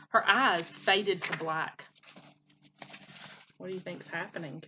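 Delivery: ordinary delivery, nothing marked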